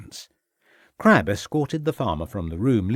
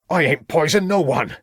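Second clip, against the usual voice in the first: higher, louder indignant denial; loud protest; 6 semitones higher, 4 dB louder than usual. A narrator's reading. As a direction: throw the denial back loudly. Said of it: "protested Troutbridge loudly".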